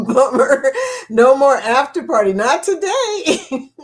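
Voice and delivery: real laughter through the words; laughing. Real, not acted. Streamer to chat about no after party, laughing. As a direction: say it through giggles, rising and slowing at the end.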